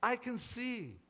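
high-pitched amazed proclamation; proclaiming. A narrator's reading. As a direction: proclaim it with rising wonder. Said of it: "she proclaimed".

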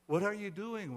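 understated anxious questioning; nervous cry. A narrator's reading. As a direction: cry out with a touch of nerves. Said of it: "one cried nervously".

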